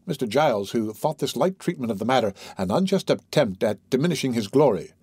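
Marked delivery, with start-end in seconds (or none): none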